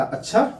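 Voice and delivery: अच्छा rising high at the end; sudden question. Shown as a demonstration. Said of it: "अचानक सा".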